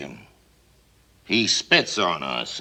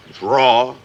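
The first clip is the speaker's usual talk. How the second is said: it's raw, thrown out loudly; punched loud; 6 semitones higher, 7 dB louder than usual. Acted. Broadcaster hyping Africa to his audience, loud. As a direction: slam the word raw.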